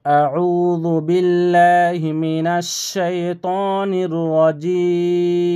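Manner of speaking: slow, drawn-out chanted recitation; Quran recitation. Shown as a demonstration. Teacher recites to students, slow chanted recitation. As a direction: recite it slowly with long held vowels.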